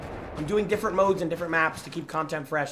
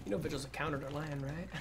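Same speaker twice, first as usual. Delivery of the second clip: teasing whisper; 2 semitones lower, 14 dB quieter than usual. Real, not acted. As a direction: whisper it with a smirk.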